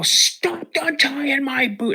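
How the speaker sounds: put-on boy screaming at dad in tree; screaming kid. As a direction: shriek it at your dad. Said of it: "screaming at my dad".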